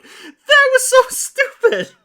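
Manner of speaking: high, loud, drawn-out squeal; slow, shrieking exclamation; indignant outburst. Real, not acted. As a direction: yell it out in exasperated disbelief. Indignant laughing outburst at the plot.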